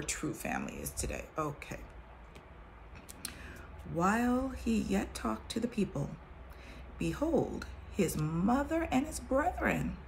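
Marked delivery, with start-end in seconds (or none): 3.9-10.0 measured King James reading voice; scripture reading. Acted. Reading the Bible to her viewers, solemn scripture voice.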